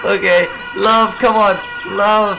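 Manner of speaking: high, loud urging; urgent plea. Pretend. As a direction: plead for love with the voice pushed up. Pleads with game characters for love.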